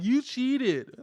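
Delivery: high, loud whiny accusation; mock accusation. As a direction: squeal it like a sore loser. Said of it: "No one's gonna be like".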